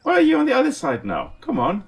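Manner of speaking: usual speaking voice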